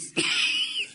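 a burst of high airless laughter; high breathy laugh. Real, not acted. Preacher laughing at his own joke, high airless laugh.

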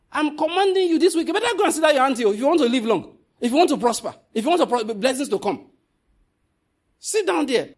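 loud, high commanding preacher; preaching command. Real, not acted. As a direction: order them to sit, full force.

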